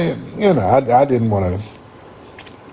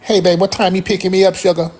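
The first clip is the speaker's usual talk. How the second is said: put-on old lady voice; 6 semitones higher than usual. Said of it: "But she had an old voice".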